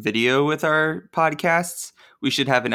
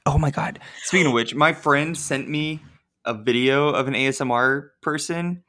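ordinary delivery

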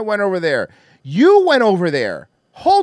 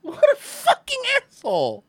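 very high, softer squeak; laughing disbelief; 5 dB quieter than usual. Real, not acted. Streamer judging the man in the clip, weak with laughter.

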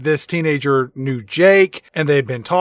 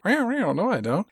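quiet refusal; 4 semitones higher, 6 dB quieter, slower than usual. Retold or imitated.

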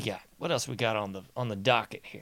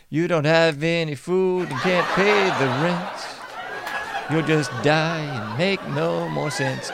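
mocking slogan; 4 semitones higher than usual. Taunting voters who can't pay rent, a laughing singsong. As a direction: sing it like a mean jingle.

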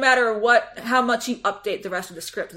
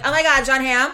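excited; 4 semitones higher, 7 dB louder than usual. Retold or imitated. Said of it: "any other time I'm like".

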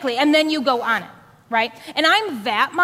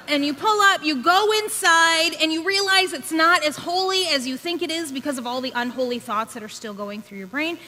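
normal talking voice

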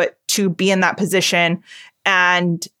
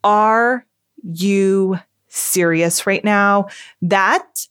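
normal talking voice

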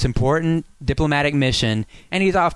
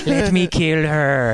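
very slow, drawn-out cartoon voice; hammed-up henchman with a put-on accent; Ren impression; much slower than usual. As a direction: stretch every word like a cartoon maniac. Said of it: "That's what he sounds like. Ren and Stimpy".

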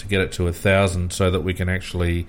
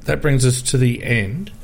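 tired, strained voice giving out; 5 semitones higher than usual. Real, not acted. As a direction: talk through a failing voice. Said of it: "because my voice is giving out".

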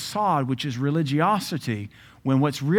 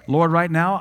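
loud mock prayer; 3 semitones higher, 7 dB louder than usual.

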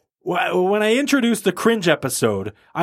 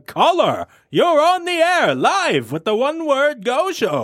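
high, drawn-out on-air announcer; mock-grand live broadcast introduction; radio show host; 10 semitones higher, slower than usual. Host welcoming a caller live on the air, hammed-up announcer.

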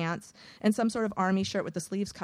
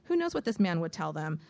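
ordinary delivery

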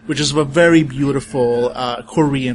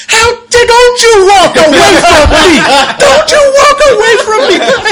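high, loud furious yell after the dog; livid shout; 12 dB louder than usual. Acted. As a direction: shriek it at the dog walking away. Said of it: "she is livid".

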